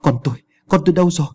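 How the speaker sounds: put-on high, fast frantic cry for her child; mother frantically searching, high-pitched; wailing cry. A narrator's reading. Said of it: "rồi lại gào khóc".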